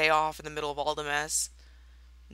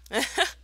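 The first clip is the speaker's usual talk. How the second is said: evil laugh; 8 semitones higher, 4 dB louder than usual. Pretend. Gloating over her revenge plan.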